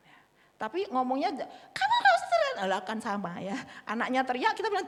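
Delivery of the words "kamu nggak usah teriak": put-on much higher and louder, yelling at a child; shouting at child. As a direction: yell "don't shout" back at the kid. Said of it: "padahal kita juga teriak ya".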